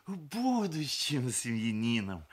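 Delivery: slightly flustered, slow, high correction; embarrassed. A narrator's reading. Bride hastily amending her praise, embarrassed. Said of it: "смутилась невеста".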